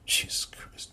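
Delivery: exasperated whisper under breath. Real, not acted. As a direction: breathe it out in disbelief. Exasperated aside.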